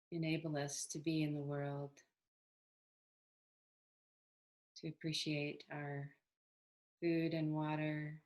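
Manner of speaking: calm, unhurried meditation guidance with long pauses; even, settled meditation voice; guided meditation. Acted. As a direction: gently lead them into body awareness, leaving space between phrases.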